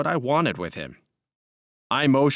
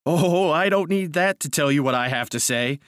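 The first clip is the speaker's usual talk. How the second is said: raised, gloating outburst; triumphant cry; 5 semitones higher, 4 dB louder than usual. A narrator's reading. Narrator defying his cousin, triumphant cry. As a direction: crow it with a long, high "oh". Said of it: "I cried with a laugh of triumph".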